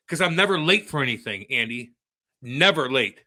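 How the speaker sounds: usual speaking voice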